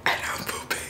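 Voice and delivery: hushed whisper aside. Real, not acted. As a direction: whisper it like a secret. Confessing on the toilet.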